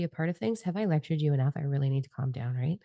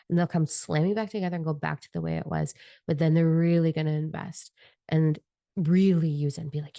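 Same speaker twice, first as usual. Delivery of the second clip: ordinary talk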